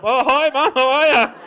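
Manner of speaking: loud, high, over-eager phone hello; hammed-up happy child; cheery kid greeting. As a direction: chirp it loudly down the phone. Kid answering mum's phone call.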